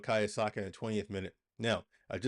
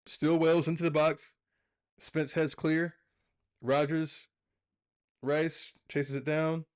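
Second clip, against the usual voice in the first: raised, keyed-up commentator call; play-by-play call; 6 semitones higher, 4 dB louder than usual. Acted. Live match commentary over the game, raised play-by-play. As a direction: call the action like a live broadcaster.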